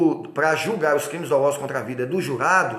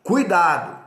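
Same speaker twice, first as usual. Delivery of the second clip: emphatic warning; 3 semitones higher, 4 dB louder than usual. Shown as a demonstration. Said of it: "em letra maiúscula, negrito e sublinhado".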